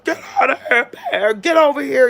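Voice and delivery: loud, high-pitched shout at a bear; screaming. Retold or imitated. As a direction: scare off the bear with a full yell. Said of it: "I'm screaming".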